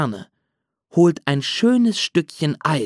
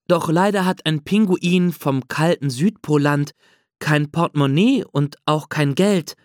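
ordinary delivery, nothing marked

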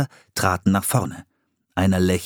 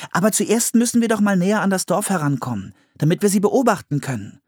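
put-on high, quick child's voice; eager child character; 11 semitones higher, faster than usual. A narrator's reading. Anne proposing to creep closer to the village, high and eager. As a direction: voice an eager girl, pitched well up.

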